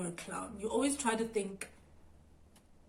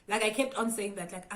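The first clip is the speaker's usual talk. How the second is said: ordinary delivery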